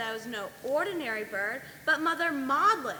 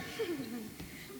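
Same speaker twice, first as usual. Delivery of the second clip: wicked cackle; 9 dB quieter than usual. Acted. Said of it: "followed by a wicked cackle".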